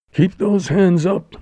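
high-pitched, forceful command; bandit's order. A narrator's reading. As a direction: order them at gunpoint. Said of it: "ordered the bandit".

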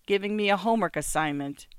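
ordinary delivery, nothing marked

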